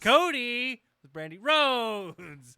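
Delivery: very loud, high name call, drawn out slowly; ring announcer. Acted. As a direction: stretch the names out for the cheap seats.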